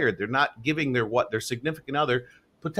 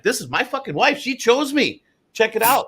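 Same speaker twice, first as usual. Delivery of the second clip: bursting with pride, a bit higher; proud, excited; 7 semitones higher than usual. Shown as a demonstration. S1 showing how proudly he introduces his wife, excited. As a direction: show her off like a trophy you earned. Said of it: "it's a pride thing".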